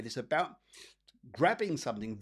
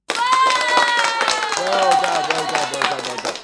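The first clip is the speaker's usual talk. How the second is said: applause effect; 14 semitones higher, 16 dB louder, much slower than usual. Real, not acted. Sound effect cheer, far.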